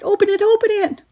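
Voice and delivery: high, loud repeated cry; little brother shouting in panic; frantic pleading. A narrator's reading. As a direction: beg it open. Kyle panicking.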